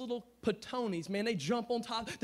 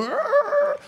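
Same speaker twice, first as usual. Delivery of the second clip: high, loud comic squeal; devil's frightened yelp; 10 dB louder than usual. Retold or imitated. Preacher imitating the devil fleeing a fierce God, comic yelp. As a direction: yelp like a devil scared of a lion. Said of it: "he makes the devil go".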